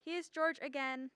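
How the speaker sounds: ordinary talk, nothing marked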